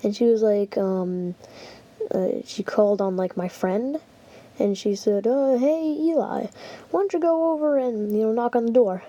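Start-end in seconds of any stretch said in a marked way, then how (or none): 5.3-9.0 higher-pitched imitation of a teacher; worried teacher. Teacher sends a friend to check, higher mildly worried voice. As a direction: lightly voice a teacher asking a favor. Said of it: "getting a little bit worried".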